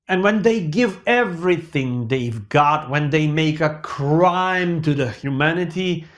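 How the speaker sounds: ordinary talk, nothing marked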